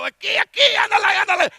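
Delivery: high, loud shouted Spanish calls; yelling in Spanish. Retold or imitated. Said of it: "yelling out the only Spanish words I know".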